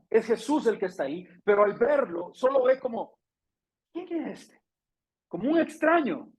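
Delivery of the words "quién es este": high, soft, bewildered ¿Quién es este?; puzzled question. Acted. Mary not recognizing Jesus at the tomb: high puzzled question. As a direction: squint at a stranger and wonder aloud.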